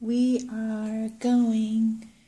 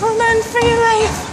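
excited; 13 semitones higher, 9 dB louder than usual. Real, not acted. Treadmill going fast, laughing.

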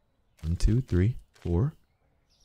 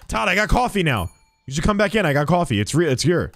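calling out; 6 semitones higher than usual. Real, not acted. Calling after departed customer.

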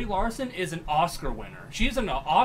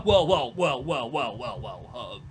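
smug well well; 9 semitones lower, slower than usual. Pretend. She mocks Jeremy, smug words fading low and slow. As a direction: let the smugness sink down.

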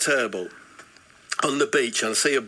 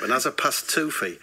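ordinary talk, nothing marked

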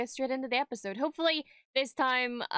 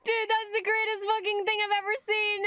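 thrilled; 9 semitones higher than usual. Real, not acted. Thrilled reaction to the scene.

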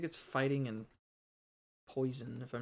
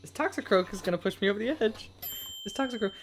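high, loud, fast outburst at the Toxicroak; near-shout of gamer rage, rushing the words; exasperated yell; 8 semitones higher, 6 dB louder, faster than usual. Real, not acted. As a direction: streamer yelling at an enemy Pokemon that won't let him escape. Raging at a game Pokemon.

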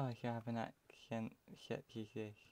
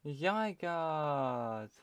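louder, higher call of a rare find; excited shout; 3 semitones higher, 5 dB louder than usual. Real, not acted. Player spotting a legendary Pokemon, excited shout. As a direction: call out the legendary's name in delight.

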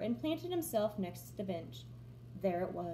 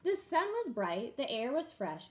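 usual speaking voice

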